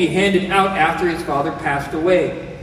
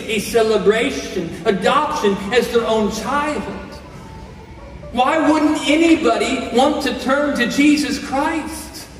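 higher, pleading preacher's appeal, often slowed; impassioned preaching; 5 semitones higher than usual. Acted. Pastor urging listeners to turn to Jesus, impassioned preaching. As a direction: press the gospel invitation home.

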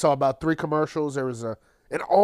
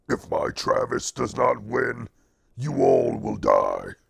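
deep demon voice, dropping very low; 4 semitones lower than usual. Retold or imitated. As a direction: growl a mock threat like a demon. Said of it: "the demon known as [name]".

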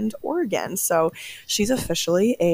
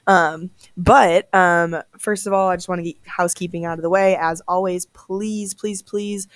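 normal talking voice

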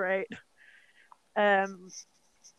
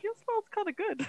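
mock creepy friend; 11 semitones higher, 4 dB quieter than usual. Friend voiced in retold movie.

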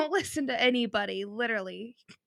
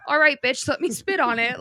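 loud, higher, scrambling to obey; hammed-up recruit snapping to orders, louder; frantic eager obedience; 6 semitones higher, 8 dB louder than usual. Mocking her own reaction to Stan's drill voice, loud and eager. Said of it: "it's that kind of voice".

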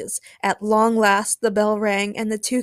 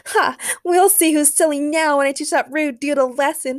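put-on high, louder girlish gloating; defiant muttering; 7 semitones higher, 4 dB louder than usual. A narrator's reading. Supergirl plots revenge while changing, defiant muttering out loud. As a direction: vow payback to yourself, not hushed. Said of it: "she muttered to herself".

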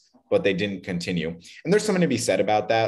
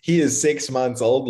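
laughing; 7 semitones higher, 4 dB louder than usual. Real, not acted. Laughs at joke about baby.

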